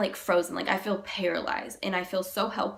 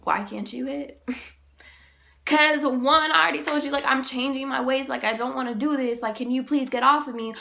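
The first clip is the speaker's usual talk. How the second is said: raised, louder indignant comeback; indignant retort; 5 semitones higher, 4 dB louder than usual. Retold or imitated. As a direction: push back, pitched up and insistent. Indignant answer to disrespectful remark.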